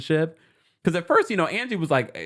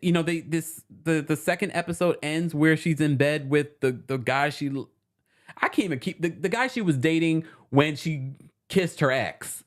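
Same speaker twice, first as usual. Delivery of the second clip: ordinary talk, nothing marked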